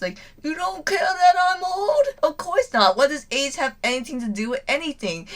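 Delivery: put-on high, teary old man voice; mock crying. Old craftsman moved to tears by the kids' friendship, mock sobbing. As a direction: choke up in an overdone way. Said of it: "Kaseki just starts crying".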